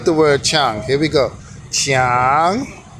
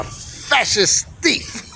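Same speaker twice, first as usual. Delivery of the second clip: slow, amused, laughing through the words; delivering the punchline while giggling; 8 semitones higher, much slower than usual. Real, not acted. Teacher explaining the funny word to viewers, laughing.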